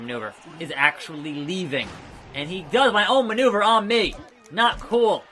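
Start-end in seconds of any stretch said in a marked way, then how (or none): 2.3-5.2 loud, high indignant protest; outraged shout at the clown; indignant outburst. Real, not acted. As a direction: protest being robbed of his move. Opponent uses his move.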